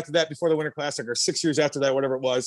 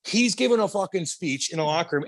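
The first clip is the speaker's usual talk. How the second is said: ordinary talk, nothing marked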